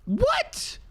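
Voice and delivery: a sudden, sky-high shocked yelp. Real, not acted. Gamer sees aquifer ruin his dig, shocked yelp. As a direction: disbelief snapping out of him.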